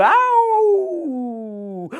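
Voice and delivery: high wolf howl; sudden high howl. Acted. Wolf howls mid speech.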